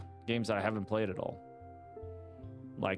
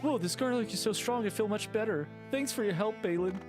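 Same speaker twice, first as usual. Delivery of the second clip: higher, cheerful shop NPC; grateful shopkeeper; 6 semitones higher than usual. Acted. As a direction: thank the hero brightly.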